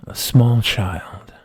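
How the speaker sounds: slow spooky whisper; hushed storyteller. Acted. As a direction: breathe it like a ghost story.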